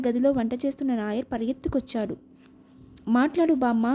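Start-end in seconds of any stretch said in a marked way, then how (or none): none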